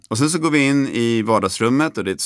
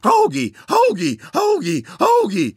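much higher, louder repeated calls to a bird; calling out; 3 dB louder than usual. Retold or imitated. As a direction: yell the name across the room, sing-song. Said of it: "börjar skrika". (mycket högt, ropande kall på fågeln)

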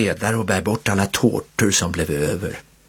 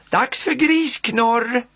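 announcing the game; 13 semitones higher, much slower than usual. A narrator's reading. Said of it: "ropar faster".

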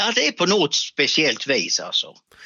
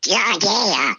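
squawky duck voice, higher pitched; Kalle Anka; 5 semitones higher than usual. Shown as a demonstration. Guest answers the host's question in a squawky duck voice. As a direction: quack the words out like a cartoon duck. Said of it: "kan prata som Kalle Anka".

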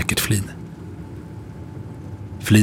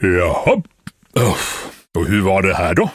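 sleepy constable's gruff question; drowsy attempt at sternness; gruff, just woken. A narrator's reading. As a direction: ask brusquely through sleepiness. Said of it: "frågade så barskt det går när man just har vaknat".

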